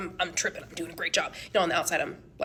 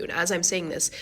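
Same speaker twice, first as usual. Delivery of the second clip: usual speaking voice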